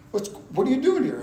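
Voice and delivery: high-pitched, re-enacted demand; startled challenge. Office man runs out to challenge new worker, startled. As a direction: ask it in alarmed disbelief.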